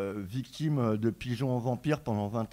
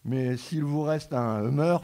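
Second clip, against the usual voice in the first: ordinary talk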